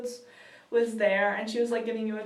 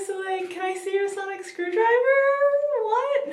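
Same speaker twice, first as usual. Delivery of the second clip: higher-pitched imitation of puzzled family; mock puzzled relative; 9 semitones higher, 4 dB louder than usual. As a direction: put on a high, questioning relative's voice. Family's reaction acted in story.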